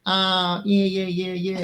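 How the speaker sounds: nasal Aussie sound, pitched well up; high, exaggerated Australian "yeah"; high Australian accent. Shown as a demonstration. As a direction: demo Australian vowels high and further back. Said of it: "kind of further back and higher".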